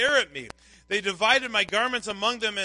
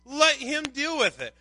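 raised-pitch mocking challenge; ridiculing; 5 semitones higher than usual. Onlookers sneer at one delighting in the Lord, ridiculing. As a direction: toss out a taunt in separated phrases. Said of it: "were ridiculing him".

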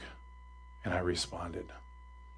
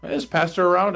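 politely asking, pitched up; higher-pitched polite inquiry; 6 semitones higher, 4 dB louder than usual. Retold or imitated. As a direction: ask courteously in a higher voice. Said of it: "but I was polite".

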